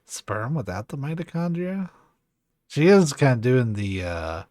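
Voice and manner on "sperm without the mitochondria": higher, rising echo of the last phrase; questioning echo. Acted. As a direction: repeat it back in disbelief, asking for more. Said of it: "with a questioning tone".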